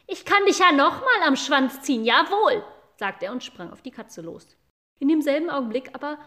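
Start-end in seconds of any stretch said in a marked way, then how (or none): 0.1-2.6 put-on loud, high defiant boy's threat; an angry boy who forgets how small he is. Boy threatens the cat, loud angry defiant voice. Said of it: "Da wurde der Junge böse".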